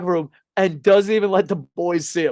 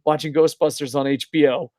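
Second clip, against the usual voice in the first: normal talking voice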